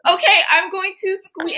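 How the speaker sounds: ordinary talk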